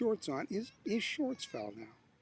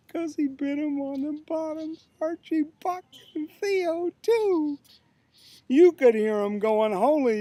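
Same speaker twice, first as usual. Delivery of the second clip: dramatic poem recitation; 2 semitones higher, 6 dB louder than usual. Acted. Poet tells of the mosquito biting again, animated recitation. Reads own poem aloud.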